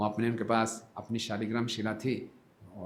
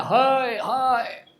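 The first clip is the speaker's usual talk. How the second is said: much higher, louder yell of hai; loud cry; 9 dB louder, much slower than usual. Acted. Brahmin yells at midnight seeing Nimai eat, loud cry. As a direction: shout it out in alarm. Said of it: "जोर से उसने चिल्लाया".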